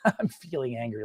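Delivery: soft, laughing through the line; laughing while saying angry. Shown as a demonstration. Teacher demos an angry line delivered laughing. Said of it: "and they're laughing".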